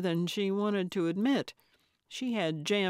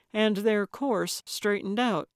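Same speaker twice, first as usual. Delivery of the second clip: usual speaking voice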